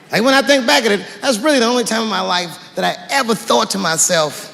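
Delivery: usual speaking voice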